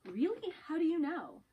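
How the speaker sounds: high, loud disbelief; surprised. Acted. Colleague reacts to a shocking secret, high surprised disbelief. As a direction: jump on the news, astonished.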